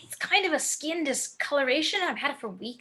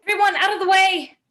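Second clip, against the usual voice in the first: loud, urgent call to clear the way; shouting; 6 semitones higher, 10 dB louder, faster than usual. Acted. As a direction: bellow it to part the crowd. Clearing a path for sick man.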